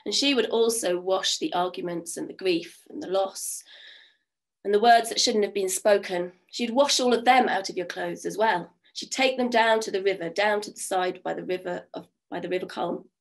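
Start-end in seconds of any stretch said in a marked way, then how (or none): none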